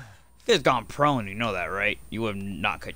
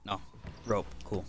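ordinary talk

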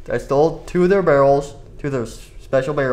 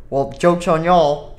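normal talking voice

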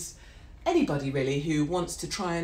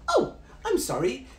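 high, exaggerated sarcastic oh; mock apology; 9 semitones higher than usual. Passenger answering airline's no-drinks excuse, mock-apologetic. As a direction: feign shock, pitched right up.

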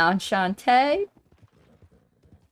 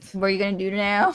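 normal talking voice

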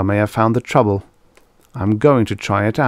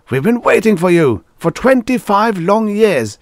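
very high, loud and emphatic; exasperated outburst; 12 semitones higher, 5 dB louder than usual. A narrator's reading. General to long-missing Adams, exasperated outburst. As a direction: cry it out after years of waiting.

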